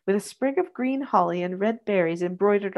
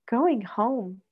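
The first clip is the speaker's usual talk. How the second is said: lightly voiced happy toddler; cheerful little boy; 2 semitones higher than usual. A narrator's reading. Freddie in the nurse's arms, a light child voice. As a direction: say it like a content small child. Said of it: "said Freddie cheerfully".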